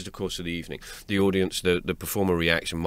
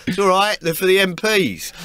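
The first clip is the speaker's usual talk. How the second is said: high, raised-voice call across the room; mock screaming; 11 semitones higher, 4 dB louder than usual. Cop reassuring the man at the scanner, mock yelling. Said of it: "this bloke screaming".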